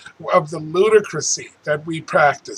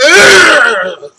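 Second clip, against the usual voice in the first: loud, high groan of frustration; sharp exasperated ugh; frustrated groan; 8 semitones higher, 9 dB louder than usual. Real, not acted. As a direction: let out a real angry ugh. Frustrated outburst in rant.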